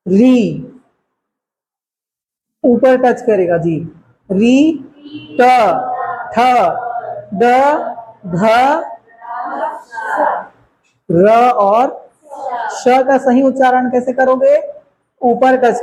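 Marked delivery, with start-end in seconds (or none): 4.3-11.5 री, ट, ठ, ड, ध, र spoken with the tongue touching the roof of the mouth; clear letter demo. Shown as a demonstration. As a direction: sound out री, ट, ठ, ड, ध, र one by one. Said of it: "अब देखो".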